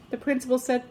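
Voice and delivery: usual speaking voice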